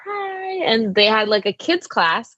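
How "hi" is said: tiny, high-pitched, soft "hi"; little child voice. Retold or imitated. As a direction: imitate your younger self timidly calling up. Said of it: "my little voice calling".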